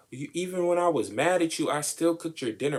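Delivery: lifted, pleading self-defence; mock it high and pleading; high mock justification; high mock whine. Quoting self-justifying partner.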